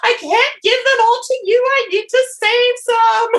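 hammed-up blubbering to a crowd of cats; mock sobbing. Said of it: "walking down the street sobbing".

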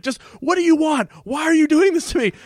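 high-pitched exasperated imitation; hammed-up fed-up protest; sarcastic exasperation. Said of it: "it was sarcastic Mac".

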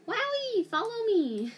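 very high, loud cheerful cry; excited. Real, not acted. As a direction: burst out with glee. Excited discovery of supplies.

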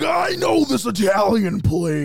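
high, loud, slow hammed-up invitation; playful exaggerated impersonation; mock Joe Rogan. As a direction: overdo an eager celebrity. Mocking Joe's dinner invitation.

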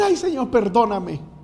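high, mock-pleading backslider; súplica imitada. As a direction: imitate someone begging to be let back. Mimics backslider returning to beg. (súplica aguda fingida)

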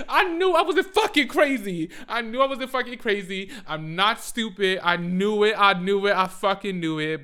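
loud, high, insistent repetition; acted vindicated outburst. Woman re-enacting finding proof of cheating, loud indignant vindication. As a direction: shout it as angry proof you were right.

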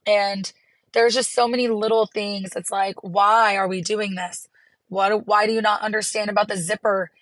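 ordinary talk, nothing marked

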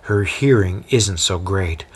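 ordinary delivery, nothing marked

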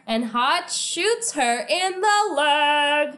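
half-sung, triumphant announcement; dramatic exclamation. Real, not acted. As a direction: stretch it out and build to the big moment. Dramatic climax of recap.

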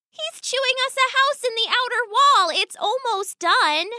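put-on very high young daughter voice; pleading daughter. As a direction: defend your sweetheart in a high, earnest voice. Daughter defends sweetheart to father.

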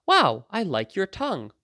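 high, swooping playful wonder; mock-admiring. Mom coaching a child to answer a stuck-out tongue, mock-admiring. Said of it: "And Mom would say".

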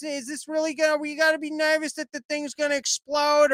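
high-pitched mock nervous whine; nervous passenger. Host mocking a nervous passenger: high, anxious whine. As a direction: whine it like a jittery rich passenger.

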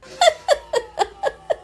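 high, squealing laugh; burst of laughter. Real, not acted. Laughing at the villain hitting the arrow, high laugh.

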